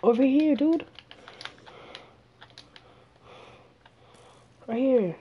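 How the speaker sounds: loud, high call across a distance; calling from far away. Real, not acted. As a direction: shout to get someone's attention.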